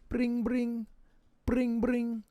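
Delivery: mock ringtone, voiced; phone ring. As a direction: imitate a phone ringing. Imitating phone ringing.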